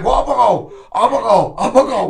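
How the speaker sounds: wife screaming back during the big fight; escalating yell. Retold or imitated. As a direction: keep hammering the question, angrier each time. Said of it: "makin tinggi, makin tinggi".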